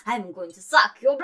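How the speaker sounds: loud Transylvanian vampire accent; Count-style Transylvanian accent. Shown as a demonstration. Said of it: "I try all the time to do the Transylvanian accent".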